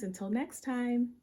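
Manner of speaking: ordinary delivery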